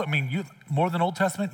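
ordinary talk, nothing marked

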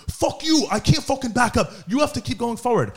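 high-pitched mock ranting; mock road-rage voice; angry driver imitation. Acts out angry driver in argument.

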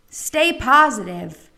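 slow, drawn-out mock encouragement; mock platitude. Cancer patient quoting what people say, mock platitude. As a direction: recite it as an empty slogan.

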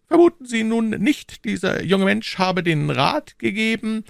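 high, cool and unhurried cardinal; phlegmatic cardinal. A narrator's reading. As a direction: say it with utter composure. Cardinal voiced.

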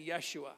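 Yeshua said as yeh-SHOO-ah; "Yeshua" with a sh in the middle, ending in oo-ah; Hebrew pronunciation. Shown as a demonstration. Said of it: "It's the exact pronunciation".

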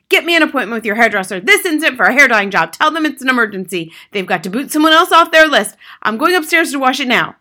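loud, urgent string of orders; father shouting. A narrator's reading. Father orders a hairdresser appointment, shouting. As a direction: bark orders in an emergency. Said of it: "the father shouted".

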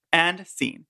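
ordinary delivery

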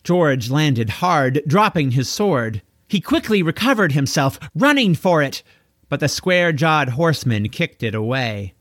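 plain reading voice

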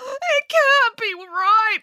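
high, slow, drawn-out wailing; whining high-pitched lament. A narrator's reading. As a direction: wail in disbelief. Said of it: "wailed Matilda".

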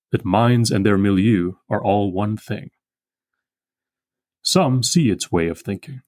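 slow, measured poetry recital; poem reading. Acted. Lecturer reading the poem aloud to the class, slow and measured. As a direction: let each line settle as you read. Reads the poem's closing lines.

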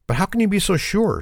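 high-pitched, disbelieving objection; acted protest. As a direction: protest like a distressed pet owner. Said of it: "she protests".

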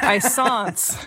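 put-on grand French-accented pronouncement, laughter in it. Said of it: "Do you think [name] is".

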